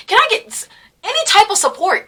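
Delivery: high, pleading ask for support. Retold or imitated. Asking the teacher for support, high and pleading. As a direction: beg sweetly for a little support. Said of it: "I don't know".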